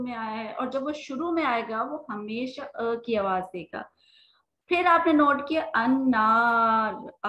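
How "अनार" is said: अनार very slow, the आ after न held long; long aa stretched. Shown as a demonstration. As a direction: stretch the नार part of अनार. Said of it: "आ की sound दे रहा है". (अनार में न के बाद लंबा आ खींचकर)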